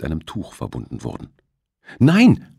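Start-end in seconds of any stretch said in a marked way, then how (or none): 2.0-2.5 a sudden high, loud cry of "Nein!"; anguished cry. A narrator's reading. Aro crying out as Rosita is blindfolded, loud and high. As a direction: cry out in horror. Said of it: "stammelte er".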